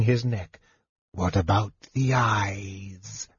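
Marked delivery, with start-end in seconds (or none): 1.1-3.3 low, slow, soft draconian menace; menacing draconian. Acted. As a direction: lean in and demand it slowly. Draconian interrogating choking captain.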